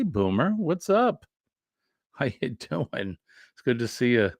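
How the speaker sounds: ordinary talk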